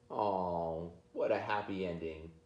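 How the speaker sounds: ordinary delivery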